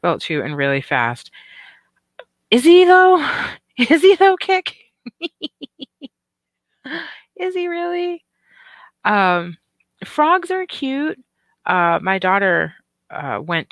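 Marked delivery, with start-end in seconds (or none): 2.5-8.2 high, loud, laughing doubt; teasing disbelief. Real, not acted. Teasing disbelief at a viewer comment. 10.0-11.2 high, slow, cutesy voice. Real, not acted. Sudden cutesy remark.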